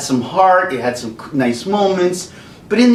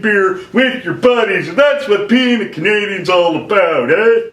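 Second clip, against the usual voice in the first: mock-proud Canadian ad guy; Canadian beer ad; 6 semitones higher than usual. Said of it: "It's like".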